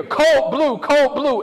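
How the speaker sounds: a staff member calling the code down the hall to the nurses. Retold or imitated. Loud urgent call. Hospital code called out in hallway.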